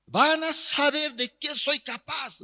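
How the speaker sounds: high-pitched furious acted threat; enraged vow of revenge; threatening. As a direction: rail at the police, voice pushed high. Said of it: "Así amenazando".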